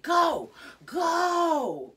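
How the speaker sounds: high, drawn-out full-voiced shout; desperate yelling. Retelling upset Jade ordering her mother out, desperate yell. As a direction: re-enact a child screaming someone out.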